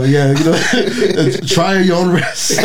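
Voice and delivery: slow words squeezed out through laughter; laughing. Real, not acted. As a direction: say it while laughing. Laughing disclaimer.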